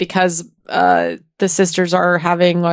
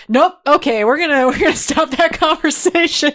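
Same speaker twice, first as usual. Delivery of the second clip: brisk shutdown; 6 semitones higher than usual. Retold or imitated. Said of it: "the queen's like".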